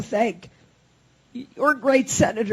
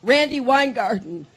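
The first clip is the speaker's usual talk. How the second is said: ordinary delivery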